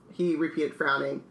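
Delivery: ordinary delivery, nothing marked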